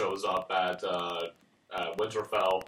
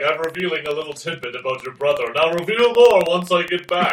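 raised, loud parody of a parting promise; mock doomed promise; 5 semitones higher, 9 dB louder than usual. Parodying the about-to-die speech about your brother, building loud.

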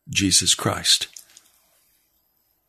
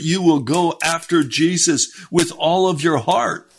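higher, urgent preacher's call; fervent preaching; 7 semitones higher than usual. Acted. Preacher urging listeners toward the Lord, fervent and raised. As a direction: lift it like a revival sermon.

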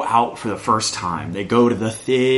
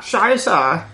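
higher, mock German curse; German accent; 8 semitones higher than usual. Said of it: "increasingly more German".

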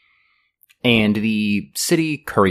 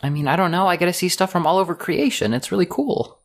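put-on high, bright young woman's voice, friendly and a little awkward; higher girlish NPC voice, eager to please; Lucy, cheerful dock girl; 7 semitones higher than usual. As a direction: play the shy, chatty dock worker.

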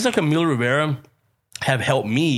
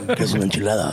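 mock Olmos voice; 6 semitones lower, much faster than usual. Mocking Olmos, laughing.

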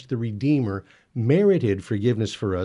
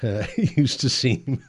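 laughing; 3 semitones higher, slower than usual. Real, not acted. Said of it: "laughs at own slow walk".

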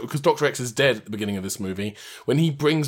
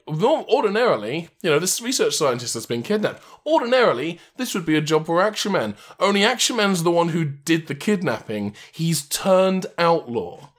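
mock radio newsreader; 5 semitones higher than usual. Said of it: "the radio news is like".

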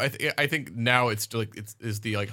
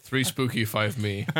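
ordinary talk, nothing marked

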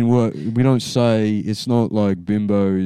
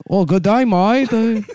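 high-pitched mock Chinese-Australian accent; 10 semitones higher than usual. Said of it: "Australian Chinese".

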